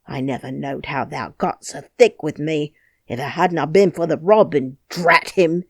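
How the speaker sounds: angry harangue. Acted. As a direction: rant at her, building scorn. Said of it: "he harangued".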